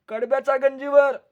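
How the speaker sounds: lightly voiced son-in-law. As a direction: answer innocently from above. Son-in-law answers from haystack.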